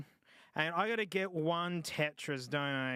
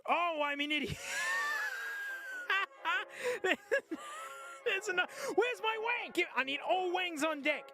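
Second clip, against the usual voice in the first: excited squeal; 9 semitones higher than usual. Real, not acted. Excited gaming win.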